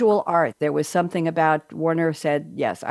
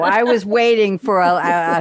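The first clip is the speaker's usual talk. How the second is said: ordinary delivery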